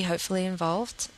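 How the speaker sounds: ordinary delivery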